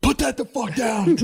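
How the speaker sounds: high, loud scolding shout; angry parent. Retold or imitated. Parent scolding kids at a friend's house, a high, loud shout. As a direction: yell at the kid to drop it. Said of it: "the parent's like".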